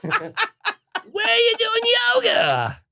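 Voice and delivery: high, drawn-out screech; hammed-up falsetto shout. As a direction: imitate a rocker shrieking with eagerness, last word stretched. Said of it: "That's my impression of you doing".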